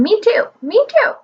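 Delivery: high screeching parrot squawk; shrill scratchy bird scream. A narrator's reading. Parrot screaming at the old man, high screeching squawk. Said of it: "A shrill, scratchy voice was screaming".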